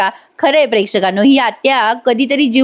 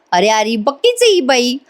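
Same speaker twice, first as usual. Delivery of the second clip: ordinary delivery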